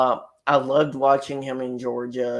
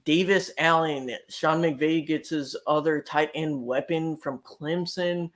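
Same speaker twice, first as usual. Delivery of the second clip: normal talking voice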